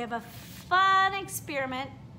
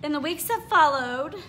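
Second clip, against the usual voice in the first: lively storybook read-aloud with character voices; reading to children; 3 semitones higher than usual. A narrator's reading. Teacher reading a picture book to her class, animated. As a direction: read it like story time for little kids.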